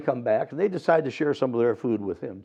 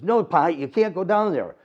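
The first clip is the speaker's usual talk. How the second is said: put-on boy voice; 6 semitones higher, 4 dB louder than usual. Boy Opie pleads with father.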